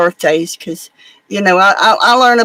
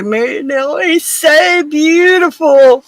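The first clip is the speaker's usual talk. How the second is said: slow, high and louder on beautiful, tearful joy; happy crying; 8 semitones higher, 4 dB louder, slower than usual. Retold or imitated. Girl tells her sister about the new baby, happy crying. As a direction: gush through happy tears, drawing out beautiful.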